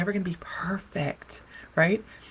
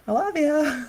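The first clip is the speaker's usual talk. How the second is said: sing-song affection; 9 semitones higher, slower than usual. Retold or imitated. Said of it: "I'm like".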